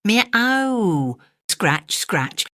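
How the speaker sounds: high meow then scratching sounds; cat meowing and scratching; cat's meow. Acted. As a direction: mew at the window. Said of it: "scuffled Simpkin".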